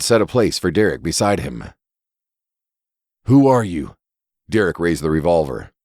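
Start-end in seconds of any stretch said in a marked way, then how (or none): 3.3-4.0 sharp, louder frightened question; frightened demand. Acted. Derek confronting the masked intruder in his kitchen, frightened demand.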